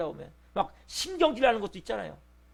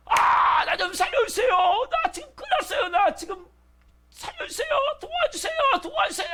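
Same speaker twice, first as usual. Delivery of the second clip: much higher, screaming cry of a drowning man; desperate shout for help. Retold or imitated. Preacher acting a drowning man, screaming high for help. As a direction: scream for rescue as the water takes you. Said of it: "막 소리 지겠죠?".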